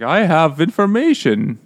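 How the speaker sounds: mock-solemn blunt delivery, slowed; tactless announcer. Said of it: "he's like".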